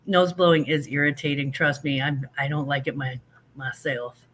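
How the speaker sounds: usual speaking voice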